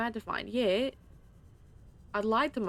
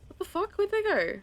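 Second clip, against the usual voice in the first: baffled; 12 semitones higher, 6 dB louder than usual. Real, not acted. Coral vanished again.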